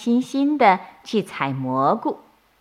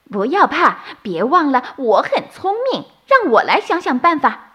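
confident bunny; 7 semitones higher, 4 dB louder than usual. A narrator's reading. Clever bunny reassures others.

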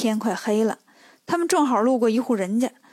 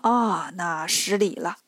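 low, drawn-out sheepish apology; slow down and lower it to excuse yourself; apologetic, ingratiating; 7 semitones lower, 3 dB quieter, slower than usual. A narrator's reading. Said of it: "陪笑说". (低声慢慢地赔笑致歉)

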